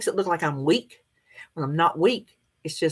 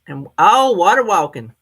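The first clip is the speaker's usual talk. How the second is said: loud, bright recommendation; enthusiastic, louder; excited; 9 dB louder than usual. Real, not acted.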